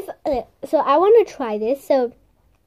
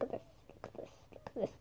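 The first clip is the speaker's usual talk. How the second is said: whisper; 23 dB quieter, faster than usual. Real, not acted. Showing off squishy up close.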